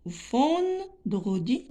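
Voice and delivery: vendredi slowly, with ven drawn out; teacher sounding out vendredi; split into syllables. Shown as a demonstration. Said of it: "trois syllabes".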